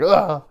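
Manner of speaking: quick mock yelp of a beaten prisoner; imitated cry of pain. Prisoner hit by guards over water, imitated cry of pain.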